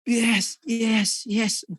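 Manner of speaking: high, bright yes yes yes like a motivator's chant; imitating Pak Erik's cheer. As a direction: mimic the motivator's rallying cheer. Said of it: "dia pasti yel, yel". (meniru yel-yel motivator dengan nada tinggi)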